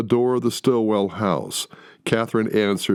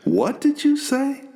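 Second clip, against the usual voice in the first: stunned. Acted. Eric stunned after punching Jack.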